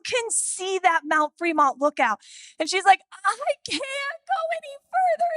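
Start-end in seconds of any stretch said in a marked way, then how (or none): 3.2-5.3 put-on higher, tearful child's whine; hammed-up weepy kid; mock bawling. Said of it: "And she's bawling".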